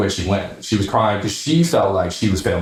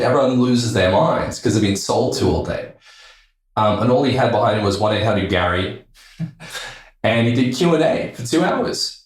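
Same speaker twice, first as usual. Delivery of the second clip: usual speaking voice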